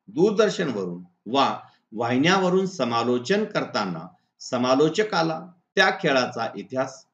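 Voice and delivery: normal talking voice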